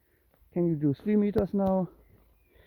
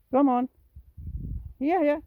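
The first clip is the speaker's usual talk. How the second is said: urging call; 9 semitones higher than usual. Real, not acted. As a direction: chivvy a pet along. Urging the cat along.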